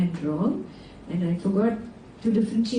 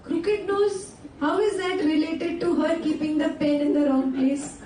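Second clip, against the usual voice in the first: incredulous questioning; 7 semitones higher than usual. Acted. Teacher questioning an insult from an acted scolding, incredulous. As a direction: query the absurd insult, pitched well up.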